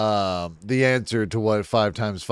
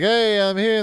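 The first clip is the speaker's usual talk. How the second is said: squeaky high cheerful hello; giddy high-voiced newcomer announcing himself; high mock greeting; 12 semitones higher, 4 dB louder than usual. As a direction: pitch way up like a goofy late arrival. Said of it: "showing up like".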